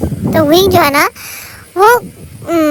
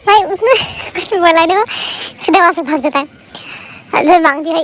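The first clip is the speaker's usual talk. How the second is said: ordinary talk, nothing marked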